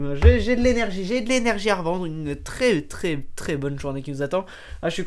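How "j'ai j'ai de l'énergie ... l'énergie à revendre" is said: excited. Real, not acted. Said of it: "je suis excité aujourd'hui".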